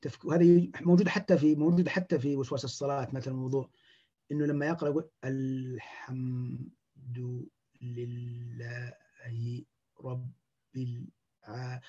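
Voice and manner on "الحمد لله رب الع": each word dragged out and cut apart with long gaps; broken slow recitation. Shown as a demonstration. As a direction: chop the Fatiha into slow separate pieces. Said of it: "فهو يفكك أو يقطع أو، أو يبطئ".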